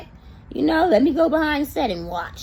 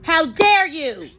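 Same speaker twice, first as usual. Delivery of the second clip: mock scolding; 7 semitones higher, 4 dB louder than usual. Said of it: "Like Tyra was yelling at Tiffany".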